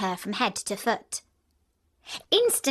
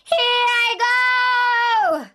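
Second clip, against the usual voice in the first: very high, loud, drawn-out cry; the witch's last shrieking cry; final wail; 13 semitones higher, 8 dB louder, slower than usual. A narrator's reading. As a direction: sing out the last cry as she melts. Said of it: "wailing, despairing voice".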